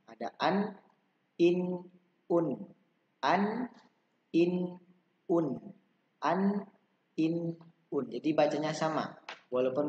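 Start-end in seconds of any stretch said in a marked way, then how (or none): 0.0-8.0 "an, in, un" sung out higher, each ending on n; repeated tanwin drill. Shown as a demonstration. Teacher repeats the endings for children to copy. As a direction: chant the endings clearly. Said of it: "itu dibacanya sama".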